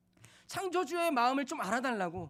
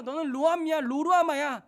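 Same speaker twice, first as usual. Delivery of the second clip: angry; 3 semitones higher, 8 dB louder than usual. Acted. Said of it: "너무 화가 나서".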